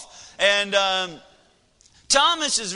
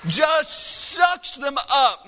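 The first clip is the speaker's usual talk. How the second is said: excited; 5 semitones higher, 4 dB louder, much slower than usual. Real, not acted. Son gobbling the candy.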